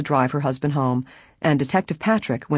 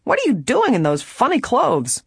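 high-pitched, baffled question; puzzled prisoner; 8 semitones higher than usual. A narrator's reading. Trespasser stares at the costumed girls, baffled. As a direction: ask in open bewilderment, pitched up.

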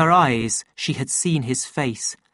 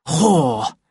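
understated curse, a little raised; angry self-talk; 3 semitones higher, 4 dB louder than usual. A narrator's reading. Savage pacing alone in anger, cursing to himself. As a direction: spit it out to himself. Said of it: "he repeated to himself".

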